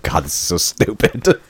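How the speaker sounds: slow, mildly exasperated grumble; retold irritation. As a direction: drag it out, fed up with the whole business. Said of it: "he's just irritated by the whole process".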